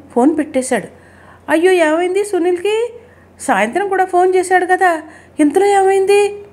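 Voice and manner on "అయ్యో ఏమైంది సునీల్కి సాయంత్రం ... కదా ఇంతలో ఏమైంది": high-pitched anxious wail in words; a mother's alarmed questions, voice pitched way up; distraught. A narrator's reading. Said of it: "Janaki ఏడుపు ఆపుకోలేకపోతోంది". (ఆందోళనగా, ఏడుపు గొంతుతో)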